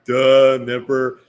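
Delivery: put-on a loud, sarcastic valley-girl duh; mock duh. Host echoing his cohost with a loud mocking duh. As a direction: toss it off like an obvious joke.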